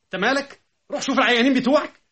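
high-pitched mock tirade of a scolding wife; shrill impression of an indignant wife; imitating his wife. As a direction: mimic her telling him off, climbing to a frantic repeated squawk. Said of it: "مرة قلت لها".

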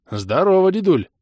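loud, high hearty greeting; young hero voice. A narrator's reading. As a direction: greet the old man heartily. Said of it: "машинально кивнул я".

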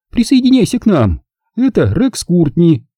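hostess voice. A narrator's reading. Zelda invites Rick to join them, high hostess voice. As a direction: welcome him in, pitched high. Zelda welcomes and introduces guests.

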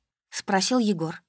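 ordinary delivery

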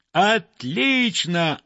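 high, drawn-out delighted cry; stretched, elated exclamation; crowd cheer. A narrator's reading. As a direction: cheer approval for the whole crowd. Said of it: "опять крикнули все".

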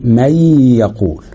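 مي يقول slowly, long nasal hum on the joined y; merging with ghunna. Shown as a demonstration. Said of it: "ان يبقى فيه غنة".

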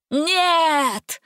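high, stretched cry of protest; cried out. A narrator's reading. Witch protests as the spell works, crying out. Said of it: "закричала Гингема".

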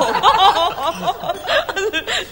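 high, loud bubbling laugh; hearty laugh. Acted. Friend laughing loudly at the general's drinking quip. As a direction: burst out laughing at the joke.

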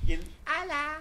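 high squeaky Alvin chipmunk voice. Retold or imitated. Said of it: "Es Alvin".

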